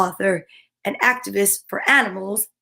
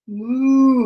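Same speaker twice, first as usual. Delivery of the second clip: long drawn-out moo; cow moo. Acted. Storyteller voicing a cow, long moo. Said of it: "says a cow".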